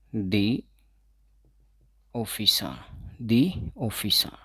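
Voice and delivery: "दी ऑफिसा" with the said as dee, slowly; the before a vowel. Shown as a demonstration. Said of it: "जैसे". (vowel से पहले "दी" बोलें)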